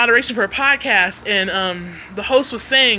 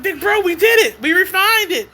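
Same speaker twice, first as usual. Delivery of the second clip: higher-pitched re-enacted celebration with a collaborator; retold triumph; 6 semitones higher than usual. Retells celebrating with producer.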